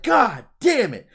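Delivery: loud, drawn-out frustrated curse; Kevin. Retold or imitated. Man acting Kevin left behind by Ruxin, loud slow exasperation. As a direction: curse slowly, high and exasperated. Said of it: "And Kevin's like".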